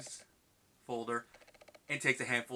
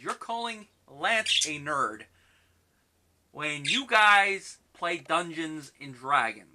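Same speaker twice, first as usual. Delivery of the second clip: slow, louder teasing call-out; mock accusing; 5 semitones higher, 11 dB louder, much slower than usual. Real, not acted. Speaker teasing Elliot and Michael, slow and louder. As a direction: drag it out to point out the irony.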